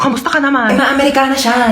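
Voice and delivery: ordinary talk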